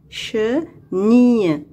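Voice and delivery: "chenille" slowly split che, nille; syllable by syllable. Shown as a demonstration. Teacher locating a sound for pupils, "chenille" slowly in two parts. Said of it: "on va cocher la deuxième syllabe".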